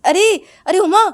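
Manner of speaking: high, loud startled call. Acted. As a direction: call her name to jolt her out of it. Girl shakes Uma, calling her.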